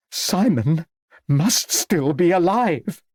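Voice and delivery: high, slow, emphatic realisation; pronouncing. A narrator's reading. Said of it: "pronounced Lady Cleves".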